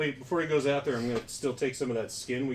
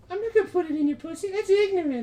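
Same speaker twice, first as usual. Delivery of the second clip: much higher, louder squeaky voice; 4 dB louder than usual. Acted. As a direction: push the voice way up, indignant. High squeaky bit continues.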